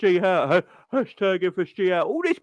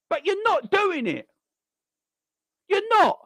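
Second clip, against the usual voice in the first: ordinary talk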